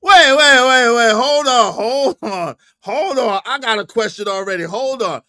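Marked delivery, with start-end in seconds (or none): 0.0-3.4 loud, rapid-fire halt; loud startled interruption easing off; urgent interruption. Real, not acted. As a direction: cut in loudly, repeating it. Interrupts the clip in shock.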